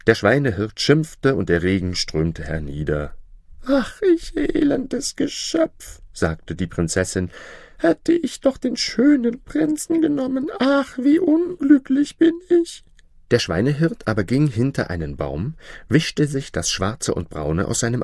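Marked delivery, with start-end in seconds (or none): 3.7-12.8 hohe, klagende Prinzessin, weinerlich; jammernd. Acted. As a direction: jammere im Regen über dein Unglück. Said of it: "Da stand sie nun und weinte". (verstoßene Prinzessin klagt weinerlich)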